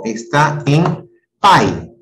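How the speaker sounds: slow, with "pai" drawn out as one syllable; slow syllable stress. Shown as a demonstration. Teacher to class, slowly isolating the syllable "pai". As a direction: stretch "pai" to show the syllable. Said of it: "¿Dónde está la sílaba".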